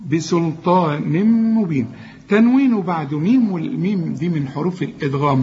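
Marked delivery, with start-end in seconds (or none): 0.1-1.9 بسلطان مبين slow, the joined meem held as a hum; merge with ghunna. Shown as a demonstration. Teacher models the recitation, held ghunna. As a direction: run بسلطان into مبين on a long nasal m. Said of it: "هنا غن بقى".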